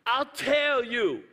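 normal talking voice

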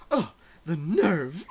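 slow, indignant mock outrage; indignant listener. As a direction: huff like an offended listener. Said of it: "they're like".

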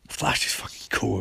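breathy whispered aside, soft; hushed admiring whisper. Retold or imitated. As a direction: whisper it like a secret in court. Hushed fan remark in court.

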